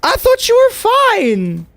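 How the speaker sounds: slow, high, loud cry of betrayal; high drawn-out yell. Real, not acted. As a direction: stretch it out in a shrill outraged wail. Streamer yells at killer in game.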